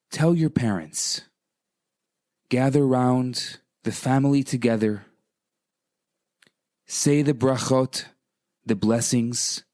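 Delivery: ordinary delivery, nothing marked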